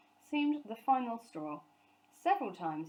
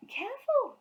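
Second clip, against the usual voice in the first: much higher, louder alarm; warning cry; 7 dB louder than usual. A narrator's reading. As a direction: cry out to stop him. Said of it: "cried Missus Brown".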